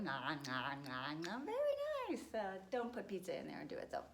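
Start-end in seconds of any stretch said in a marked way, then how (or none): 0.0-1.4 low, exaggerated nom-nom chewing noises; open-mouthed chomping. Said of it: "chew with your mouth open". 1.4-2.2 very high, squealed silly voice; silly mock praise. Teacher praising the class's messy chewing, silly high voice.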